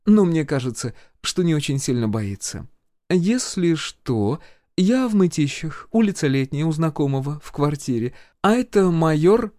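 high-pitched female healer, unhurried; healer, sweetly telling tales. A narrator's reading. Healer gives her friend the address, sweetly composed.

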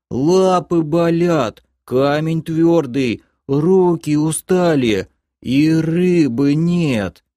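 high, very drawn-out pitiful creature voice; plaintive whining. A narrator's reading. Captive creature listing its woes, plaintive whining. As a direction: drag each complaint out in a piercing whine. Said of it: "пожаловался тот же голос".

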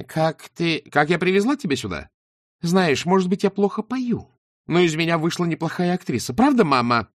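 high, loud petulant whine; whiny California brat. Acted. Shayana mocking Beryl as her spoilt stepdaughter, high whine. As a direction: mimic a spoilt stepdaughter's whining. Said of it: "капризное калифорнийское нытьё Присциллы Блинхейм".

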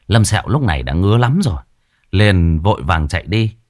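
plain reading voice

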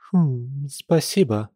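sheepish and a little subdued; abashed. A narrator's reading. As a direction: thank shyly after an unsure hum. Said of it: "стушевалась лиса".

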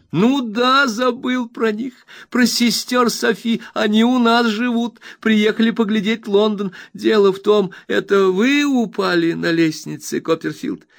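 high, bright-eyed friend overjoyed; eager Traddles. Acted. Traddles reassures his old friend Copperfield, eager high voice. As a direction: gush with happiness at the reunion.

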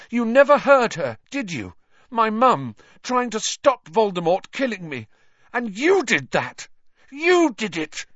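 high, loud, trembling accusation; shaking with rage. A narrator's reading. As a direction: shout through shaking fury. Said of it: "his voice shook more than ever".

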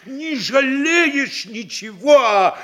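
high, drawn-out show of devotion; effusive self-praise. Acted. Mayor boasting of his service to the official, effusive. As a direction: lift it high and plead your zeal.

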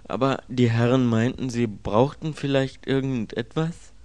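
a polite servant begging pardon; deferential servant. Acted.

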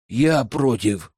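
cold, slow, clipped refusal; through gritted teeth. A narrator's reading. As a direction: bite the words out slowly. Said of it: "процедила ведьма". (сквозь зубы)

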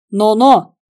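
loud warning cut-in; sharp interruption. A narrator's reading. Karavaev interrupts Igor's lesson in knocking people out, warningly. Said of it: "прервал мастер-класс Караваев".

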